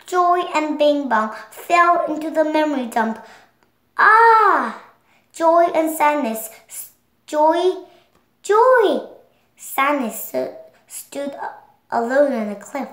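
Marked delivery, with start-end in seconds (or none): 4.0-4.8 high falling scream as she drops; a startled cry, loud and high; short loud scream of falling. Acted. Joy falls into memory dump. 6.7-9.1 calling out. Acted. Sadness calls to falling Joy.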